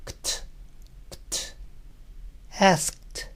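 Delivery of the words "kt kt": "Kt" as a soft, voiceless k and t with no vowel; just the two consonants, breathed quietly; bare k-t cluster. Shown as a demonstration. Teacher demonstrating the sound cluster to students, soft voiceless. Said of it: "Imaginen diciendo K y T sin vocales".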